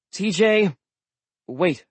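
usual speaking voice

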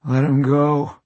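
husky wet voice, barely clear. Acted. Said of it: "in a wet and husky voice".

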